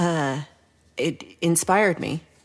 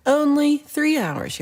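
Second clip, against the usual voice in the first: only three hours, slow, higher and louder; incredulous exclaim; 9 semitones higher, 4 dB louder, slower than usual. Real, not acted. Reacting to a viewer's turkey claim with slow disbelief. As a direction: stretch it out in disbelief. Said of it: "It on-, only takes three hours".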